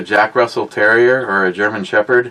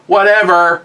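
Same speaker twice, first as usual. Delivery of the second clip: raised, higher-pitched mock retort; dismissive retort; 7 semitones higher, 4 dB louder than usual. Eric calls from the van, dismissive. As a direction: imitate a coworker's loud brush-off.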